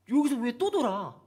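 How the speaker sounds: exasperated why-again; frustrated complaint. Retold or imitated. Voicing frustrated Israelites. (짜증 섞인 백성의 불평)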